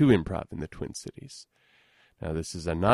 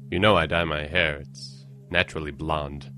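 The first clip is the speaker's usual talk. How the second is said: normal talking voice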